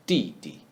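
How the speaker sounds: di, di with the second di light; neutral tone. Shown as a demonstration. Teacher modelling didi with a reduced second syllable. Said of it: "the second character should be reduced".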